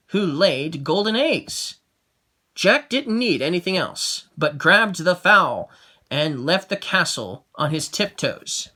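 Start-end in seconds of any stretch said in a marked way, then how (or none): none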